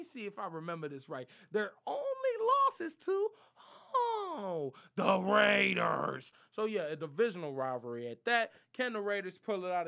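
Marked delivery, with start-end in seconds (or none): 1.5-6.3 mock anguish. Mock dramatic reveal of Chiefs' loss.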